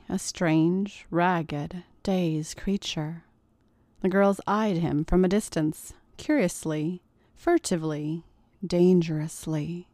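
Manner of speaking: ordinary delivery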